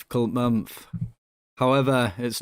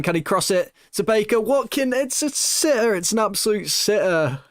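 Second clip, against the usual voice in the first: excited rising call; 8 semitones higher than usual. Real, not acted. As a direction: voice climbing much higher on a near miss. Near miss of easy chance.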